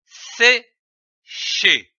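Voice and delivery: SÉCHER in two separate beats, a pause between; split into syllables. Shown as a demonstration. Teacher combining two syllables into a verb, split into syllables. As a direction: pronounce "sécher" one syllable at a time. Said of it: "L'association de ces deux syllabes".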